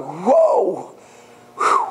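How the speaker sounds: high startled whoa and relieved whew. Retold or imitated. Climber swinging onto a branch, startled whoa. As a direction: gasp as he swings and catches the branch. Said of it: "He's like".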